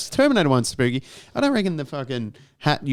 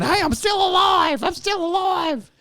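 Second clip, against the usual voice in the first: very high, drawn-out frantic cry; hammed-up panicked yell for rescue; mock freak-out shout; 3 dB louder than usual. Buried person calling from the grave, mock freak-out. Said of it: "They weren't just freaking out".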